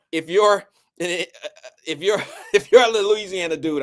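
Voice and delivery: breaking into laughter mid-phrase; can't get the words out for laughing; stumbling over the words, laughing. Real, not acted. Laughs through teasing Louisiana dudes.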